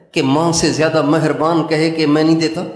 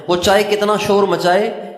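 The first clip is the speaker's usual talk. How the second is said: normal talking voice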